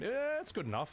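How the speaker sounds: ordinary talk, nothing marked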